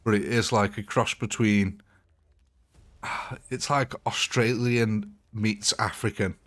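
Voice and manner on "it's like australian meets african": ordinary talk, nothing marked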